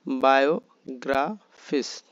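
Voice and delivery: বায়োগ্রাফিস slowly split as বায়ো, গ্রা, ফিস; three syllable groups. Shown as a demonstration. Teacher shows বায়োগ্রাফিস in three parts, slowly separated. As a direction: leave gaps inside বায়োগ্রাফিস. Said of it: "তিন ভাগে".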